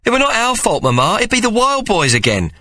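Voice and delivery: put-on a small boy insisting it's not his fault; indignant young Tomo. Tomo protesting to his mother, high indignant child voice.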